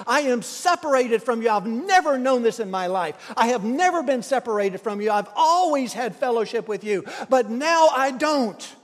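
loud, pleading cry to God; a preacher crying out in anguish. Retold or imitated. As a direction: cry it out as if forsaken. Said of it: "And he's crying out".

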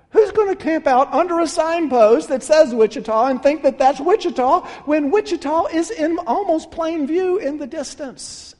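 rising preaching. Real, not acted. Preacher asking the congregation, rising incredulous force. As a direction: hammer the absurdity home.

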